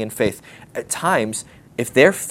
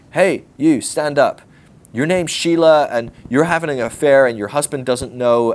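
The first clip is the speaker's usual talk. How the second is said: public call-out; 2 semitones higher, 4 dB louder than usual. Retold or imitated. Showy prophet singling out a woman in church, loud mock call-out. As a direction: bark it across the room like a showy prophet.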